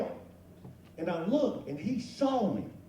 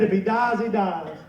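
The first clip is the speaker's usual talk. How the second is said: laughing quote; 3 semitones higher, 8 dB louder than usual. Retold or imitated. Deadpan quote while laughing.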